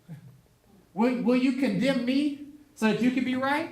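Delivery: God's challenging voice. Acted. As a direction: lightly play God putting Job on the spot. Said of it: "they don't think God talked like this".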